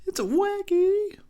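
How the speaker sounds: high, slow squeaky repeat; a silly high slow echo; high mock echo. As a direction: squeak the line back slowly. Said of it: "This movie's wacky".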